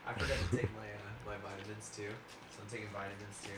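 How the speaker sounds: distant, away from the microphone; talking from across the room; off-mic. Real, not acted. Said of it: "[name]'s not even next to the microphone".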